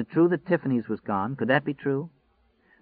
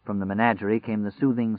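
ordinary delivery

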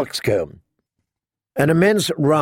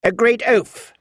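put-on higher, tart old lady's correction; Queen of Thorns; 9 semitones higher than usual. A narrator's reading. Lady Alina mocking her son, tart and higher. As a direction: correct her with withering scorn. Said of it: "said the Queen of Thorns".

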